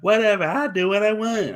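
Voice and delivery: half-joking imitation of a defiant cartoon kid; Cartman. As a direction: toss it off as a bratty cartoon impression. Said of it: "why she sound like Carmen".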